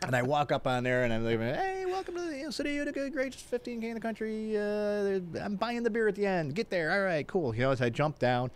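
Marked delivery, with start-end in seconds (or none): 1.4-7.5 high-pitched, projected crowd welcome; race-start announcer. Retold or imitated. Mayor welcoming runners at the start line, high announcer call. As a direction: imitate addressing thousands from the podium.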